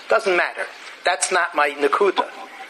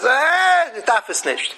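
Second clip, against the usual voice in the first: put-on dismissive kid; 6 semitones higher, slower than usual. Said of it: "the kid says".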